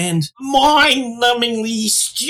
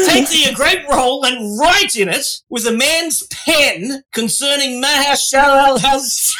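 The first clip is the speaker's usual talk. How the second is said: slow, higher mock chef reading; shaky attempt at a British chef; Gordon Ramsay; 5 semitones higher, slower than usual. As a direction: read it out like an exasperated chef, lightly. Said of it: "this is Gordon Ramsay themed".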